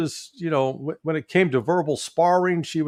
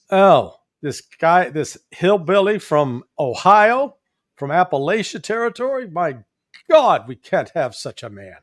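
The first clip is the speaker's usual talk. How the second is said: mock snobbery; 4 semitones higher, 4 dB louder than usual. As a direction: act the scandalized East Coast snob. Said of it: "it's that East Coast elite snobbery".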